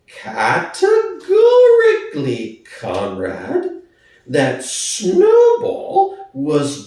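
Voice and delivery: high, slow, weighty pig voice; slow and firm. Acted. Squealer announcing to Boxer, slow and firm. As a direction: lay down each word slowly, firmly. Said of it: "speaking very slowly and firmly".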